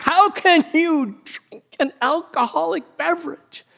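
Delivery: scandalised preacher scolding, voice cracking high; indignant outburst. Acted. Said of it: "he just bursts out".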